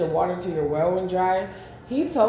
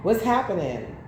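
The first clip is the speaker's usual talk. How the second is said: ordinary talk, nothing marked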